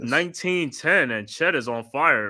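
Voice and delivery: steady broadcast play-by-play, calling each pass. Acted. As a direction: call the game like a TV announcer. Live play-by-play call.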